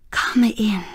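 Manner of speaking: hushed whisper at the door; whispered welcome. A narrator's reading. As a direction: breathe the welcome, barely voiced. Wife greets the arriving nurse.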